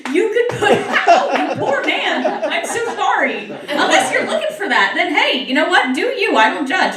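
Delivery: high and laughing through the words; laughing apology. Real, not acted. Comic apologizing to a man in the crowd, laughing. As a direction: giggle your way through the sorry.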